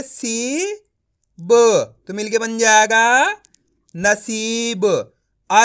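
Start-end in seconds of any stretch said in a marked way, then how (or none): 0.0-0.7 सी softly, ई held long; drawn long ii. Shown as a demonstration. Teacher models for students, soft and stretched. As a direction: stretch सी gently. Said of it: "तो सा को अपन कैसे पढ़ेंगे?".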